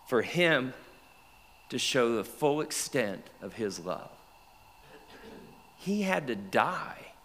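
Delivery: usual speaking voice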